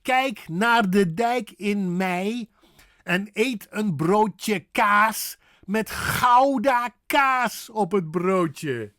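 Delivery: slow, loud Dutch kijk, dijk, Gouda and broodje; deliberate Dutch with rasped Gouda and stretched kaas; parrot-me model. Shown as a demonstration. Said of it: "Just parrot me".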